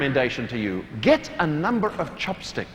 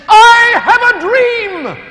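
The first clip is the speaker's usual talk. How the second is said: slow, much higher, loud preacher's cadence; Martin Luther King; 11 dB louder, slower than usual. Retold or imitated. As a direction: ring out "I have a dream" like a great orator. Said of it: "he said".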